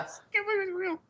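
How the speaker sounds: very high, breathless and giddy; giddy disbelief. Real, not acted. Giddy squeal telling friends the game was real. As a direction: still squeaking with joy. Recalls the Shenmue 3 reveal.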